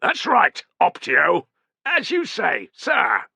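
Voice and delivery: high, loud, clipped reply; sharp officer retort. Acted. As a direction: answer back hard. Tense exchange between officers.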